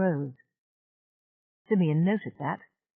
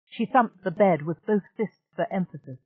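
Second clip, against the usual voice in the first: ordinary delivery, nothing marked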